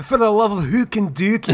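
high, hammed-up Scottish groundskeeper; Willie's Scottish brogue. Quoting the Simpsons to friends, mock Scottish outburst. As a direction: exclaim it in a comic Scottish accent. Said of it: "I love that Willie".

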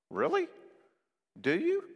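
high-pitched, doubtful challenge; skeptical questioning. Acted. Counselor challenging what Christians are told, skeptical.

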